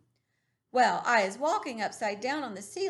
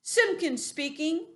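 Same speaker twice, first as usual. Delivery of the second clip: slow, higher, self-important phone greeting; important telephone voice; 5 semitones higher, 3 dB louder, slower than usual. Acted. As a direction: answer the phone like someone very important. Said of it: "in his very important telephone voice".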